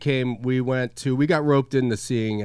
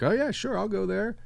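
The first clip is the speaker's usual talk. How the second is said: high-pitched, softer mimic of gullible recruits; eager audience recruit; 10 semitones higher, 4 dB quieter than usual. Host imitates people offered twenty dollars, high eager mimicry. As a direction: mimic an easy yes in a high voice. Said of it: "And they're like".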